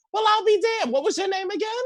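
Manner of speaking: shocked prissy lady asking again; high-pitched offended lady voice. Retold or imitated. Imitating the other lady.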